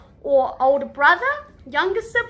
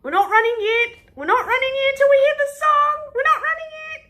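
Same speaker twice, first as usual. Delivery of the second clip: excited warning; 8 semitones higher than usual. Acted. Playing chase game with kids.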